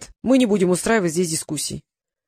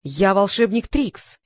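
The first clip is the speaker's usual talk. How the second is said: slow, each word weighed, stress on волшебник; proud slow declaration; slower than usual. A narrator's reading. Trix revealing himself to the god, slow proud declaration. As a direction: announce yourself slowly, leaning on волшебник. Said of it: "воскликнул Трикс".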